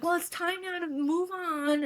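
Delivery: high, prissy imitation of friends; sing-song mock of know-it-all advice; imitating friends who push her to move on, pitched high.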